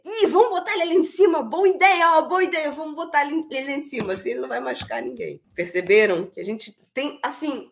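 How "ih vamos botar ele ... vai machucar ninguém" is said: high, bright, fast playful voice; cheerful high voice, like playing with a toddler; playful suggestion. Shown as a demonstration. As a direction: make it a game for the child.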